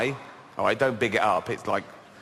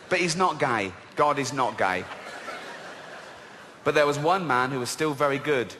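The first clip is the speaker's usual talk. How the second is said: ordinary delivery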